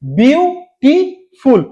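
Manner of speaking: Beautiful very slowly in three separate beats; syllable by syllable. Shown as a demonstration. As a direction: say বিউ, টি, ফুল with a clear stop after each. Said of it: "তিনবার থামতে হচ্ছে".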